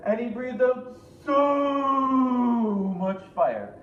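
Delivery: loud, slow, shouted roar of fire; fire-breathing shout. A narrator's reading. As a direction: bellow it out like flames.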